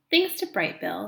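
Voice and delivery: ordinary delivery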